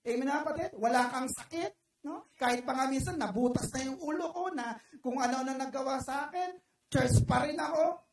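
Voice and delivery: ordinary talk, nothing marked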